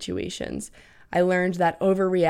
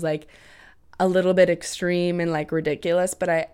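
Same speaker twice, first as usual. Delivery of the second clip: ordinary talk, nothing marked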